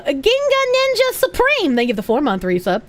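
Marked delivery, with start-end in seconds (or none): 0.0-1.7 squeaky voice. Pretend. High pitched squeal on a donor name.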